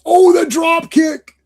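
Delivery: high, drawn-out cry of amazement; excited wrestling call. Acted. Podcaster hails the comic's drop kick, excited high call.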